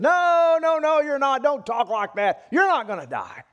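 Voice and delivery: high, loud outburst easing down; acted loud protest. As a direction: cut in with an urgent objection. Peter protests at Jesus' death.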